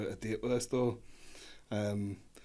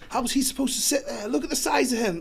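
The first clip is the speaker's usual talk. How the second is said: mocking drunk date; 14 semitones higher, 5 dB louder than usual. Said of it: "And she was all like".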